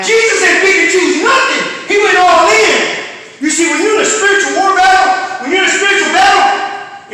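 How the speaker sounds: high, loud preaching cry that climbs; rising sermon shout; full-force preaching. Acted. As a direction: preach it out at the top of your voice.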